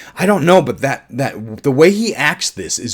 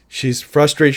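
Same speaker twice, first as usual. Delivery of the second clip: ordinary talk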